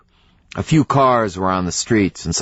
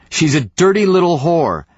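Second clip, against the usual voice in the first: voice climbing; 8 semitones higher, faster than usual. A narrator's reading. Wife shouting at husband's back, voice climbing. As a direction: build from sharp to screaming at him. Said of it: "her voice climbing".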